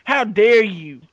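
put-on scandalized protest; mock indignation. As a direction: draw it out in pretend outrage. Mock outrage at the other host.

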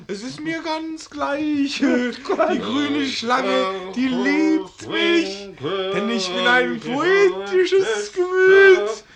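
hoch, laut, langsam deklamiert; pathetischer Ausruf. Acted. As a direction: trunken schwärmend hinausrufen. Betrunkener schwärmerischer Ausruf. (betrunken schwärmend laut und langsam)

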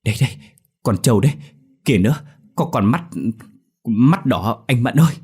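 loud, high frightened pleading; trembling. A narrator's reading. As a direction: tremble while pointing. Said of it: "run run chỉ về con trâu".

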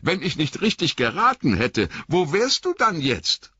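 high, hurt, shivering reproach; a wet hobbit stung by his master; Sam, cold and soaked, reproaching Frodo in a high voice. Acted.

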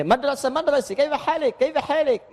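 high mock "kaifa halik" said as "keva helek"; singsong Levantine imitation bending A to E; playful Levantine accent; Levantine Arabic accent. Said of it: "banyak itu yang E".

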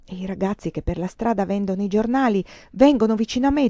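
usual speaking voice

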